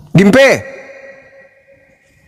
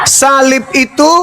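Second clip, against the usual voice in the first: shouted emphasis; 7 semitones higher, slower than usual. Real, not acted. Preacher shouting down his debate rival. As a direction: hammer the point home.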